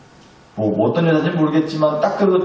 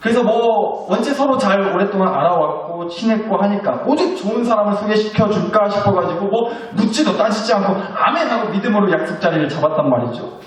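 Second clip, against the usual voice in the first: normal talking voice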